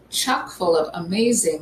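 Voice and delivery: ordinary talk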